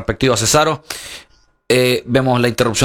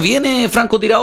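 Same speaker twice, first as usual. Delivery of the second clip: excited; 11 semitones higher than usual. Real, not acted. Excited live commentary call.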